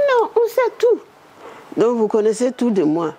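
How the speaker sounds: very high squeaky mock voice; Prime Minister's quip. Woman voicing the Prime Minister's knowing reply, very high. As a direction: toss it off knowingly.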